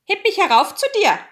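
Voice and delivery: high, loud, quick frog call. A narrator's reading. Frog calls up from beside the chair, high and loud. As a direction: demand it fast and high-pitched. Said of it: "Da saß er und rief".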